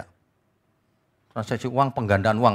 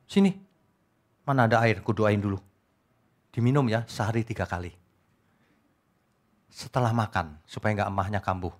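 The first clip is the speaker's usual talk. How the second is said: a lightly voiced faith healer, softer; mock healer; 6 semitones lower, 6 dB quieter than usual. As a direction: mimic a sweet-talking healer, hushed. Mocking a healer's instructions. (tiruan dukun, pelan dan lembut)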